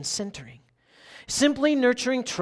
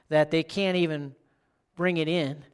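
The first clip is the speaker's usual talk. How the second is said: ordinary delivery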